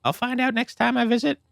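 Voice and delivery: put-on very high child voice. Little girl retaking her line in a very high child voice. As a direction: chirp it like a small kid.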